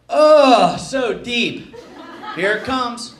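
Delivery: hammed-up suggestive groaning, imitating the podiatrist; mock moaning. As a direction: burst out with a loud, long "Oh".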